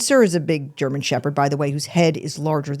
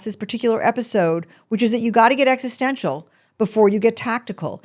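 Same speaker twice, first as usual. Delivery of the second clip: ordinary talk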